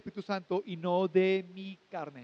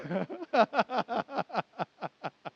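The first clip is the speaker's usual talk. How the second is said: laughing. Real, not acted. Laughs after joke.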